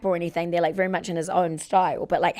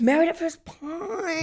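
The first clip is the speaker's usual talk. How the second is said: high, drawn-out playful title call; lightly hammed-up show-title delivery; mock show title; 8 semitones higher, slower than usual. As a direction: stretch the last word in a high mock announcement. Mock-performs the imaginary show title.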